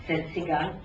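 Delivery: ordinary delivery, nothing marked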